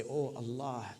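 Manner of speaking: ordinary talk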